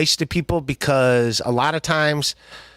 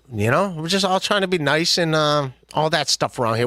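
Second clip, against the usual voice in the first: ordinary talk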